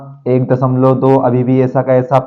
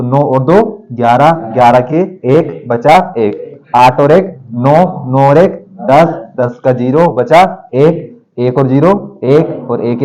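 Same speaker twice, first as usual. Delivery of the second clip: slow step-by-step counting; slower than usual. Shown as a demonstration. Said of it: "teacher demonstrates addition slowly".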